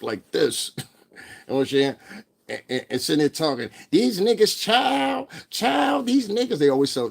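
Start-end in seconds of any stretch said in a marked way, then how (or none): 3.9-6.6 very high, slow drawn-out sassy mock; high hammed-up gossip voice. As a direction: camp it up, stretch out child. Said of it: "talk like that".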